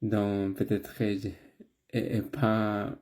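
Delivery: non-native French, careful and hesitant; foreign accent in french. Real, not acted. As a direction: speak French as a learner from abroad. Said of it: "j'ai pas une bonne prononciation".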